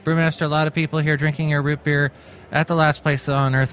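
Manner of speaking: ordinary talk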